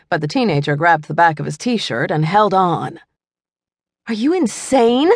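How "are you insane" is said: higher, incredulous demand; demanding sister. A narrator's reading. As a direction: scold her brother in disbelief. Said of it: "the teenager demanded of her brother".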